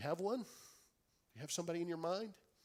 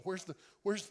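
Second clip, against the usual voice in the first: ordinary talk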